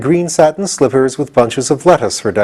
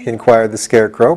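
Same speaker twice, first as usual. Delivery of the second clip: plain reading voice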